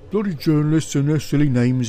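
ordinary delivery, nothing marked

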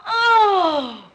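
loud, high wailing cry; long melodramatic wail of dismay, falling away; anguished wail. Acted. Mother appalled at daughter marrying Dick Brunton, wailing.

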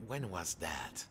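usual speaking voice

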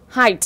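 "height" with an audible initial h; sounded H. Shown as a demonstration. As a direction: breathe out the h that starts "height". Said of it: "âm đó được phát ra là h". (đọc "height" có phát âm h)

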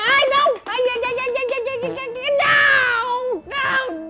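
high, loud squealing ai ai ai; panicked cries. Real, not acted. Caught by the man in the game, high panicked squeals. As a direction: squeal in playful panic as you get caught.

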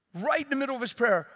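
ordinary talk, nothing marked

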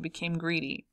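usual speaking voice

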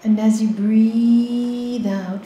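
ordinary talk

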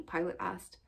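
usual speaking voice

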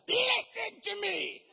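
loud, high, slow furious order; angry shout. Retold or imitated. Wife in the car shouts at Mark, slow furious order. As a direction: bellow it slowly like a furious wife.

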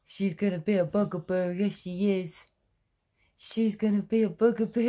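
put-on teasing baby talk. Mother playfully teasing her daughter about being a bugaboo, sing-song. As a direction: tease her with a bouncy little rhyme.

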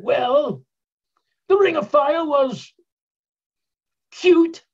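high, loud mock British gent, ending on a shout; British accent, rising to a loud final word. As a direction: build to a dismissive punchline in a put-on English voice. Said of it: "they really do talk like that".

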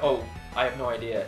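ordinary talk

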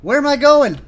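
high, loud confused cry; real startled, high-pitched outburst; alarmed yelp. Real, not acted. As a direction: yelp it as you veer off course. Loses control in the game.

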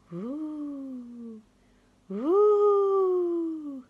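high loud howling wind. Acted. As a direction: howl like the wind. Wind noise in story.

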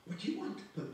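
high, loud, agitated woman's voice; flustered scolding woman. Acted. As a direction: complain loudly, voice leaping high.

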